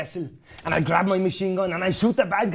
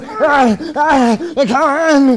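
hammed-up fight cries; 7 semitones higher, 9 dB louder, slower than usual. Mock fight, [name] roaring.

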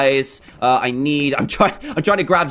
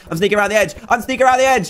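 higher and more urgent each time; mounting excitement; 5 semitones higher, much faster than usual. Real, not acted. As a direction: pitch climbing with each repeat. Sneaking up, tension building.

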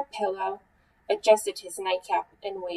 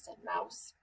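ordinary delivery

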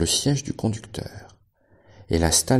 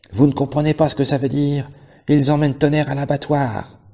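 desperate shout; 8 semitones higher, 6 dB louder than usual. A narrator's reading. Benjamin shouting the horror.

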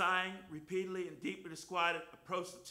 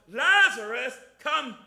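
booming call, much louder; loud shout; 2 semitones higher, 11 dB louder, much slower than usual. Retold or imitated. Jesus calling Lazarus out of the tomb, loud commanding shout. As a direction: call him out of the tomb at full voice. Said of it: "he shouted with a loud voice".

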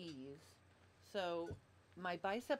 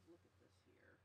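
breathy whisper to herself, away from the mic; whispered aside; 24 dB quieter than usual. Real, not acted. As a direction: whisper it under your breath. Muttering to herself fixing mic.

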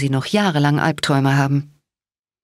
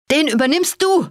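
high-pitched urgent call; raised-pitch battle command; 10 semitones higher than usual. A narrator's reading. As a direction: shout an order across the fight, without much extra volume. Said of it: "rief ich".

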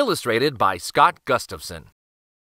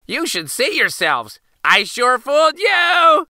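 put-on laughing, gloating boy; 5 dB louder than usual. A narrator's reading. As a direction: gloat loudly in a high boyish voice. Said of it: "The boy laughed when he saw their worried faces".

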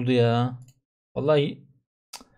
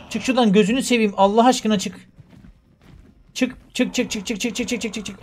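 frantic urging; 6 semitones higher than usual. Real, not acted. Begging character to get out.

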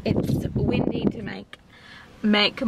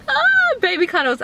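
gushing excited; 12 semitones higher, 5 dB louder than usual. Real, not acted. Gushing over baby cuddles.

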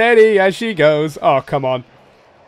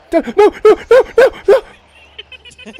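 stretched, rising panicked no-no-no; 11 semitones higher, much slower than usual. Real, not acted. A hunter panicking at the monster, drawn-out cries. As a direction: dread as the monster turns.

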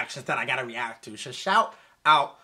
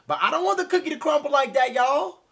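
high fast protest; 10 semitones higher, 7 dB louder than usual. Real, not acted. Protesting the sad ending.

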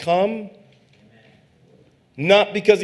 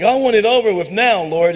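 higher, louder demanding prayer; impatient plea; 4 semitones higher, 6 dB louder than usual. Retold or imitated. Preacher voicing his impatient prayer, loud and high. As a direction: insist to God, wanting it done.

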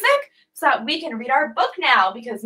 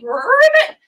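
high croaking ribbit; frog ribbit; 10 semitones higher, 4 dB louder than usual. Shown as a demonstration. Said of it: "rip it like a frog".

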